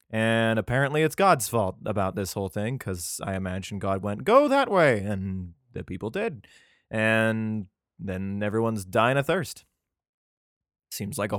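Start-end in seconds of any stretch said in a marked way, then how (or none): 4.2-5.0 much higher, bright and casual order; breezy God voice. Pretend. God pointing the people toward the desert, higher casual command. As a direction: toss off the order carelessly. Imagined God giving directions.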